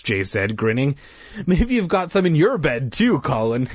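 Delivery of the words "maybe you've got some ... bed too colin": higher, teasing lilt; amused teasing. A narrator's reading. Jay teasing Colin about snakes, amused. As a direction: tease a friend, pitched up. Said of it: "He laughed".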